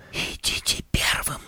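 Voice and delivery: sly honeyed whisper; conspiratorial whisper. A narrator's reading. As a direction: whisper it like a secret plan. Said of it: "заговорщически шепнула она".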